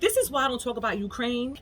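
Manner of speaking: normal talking voice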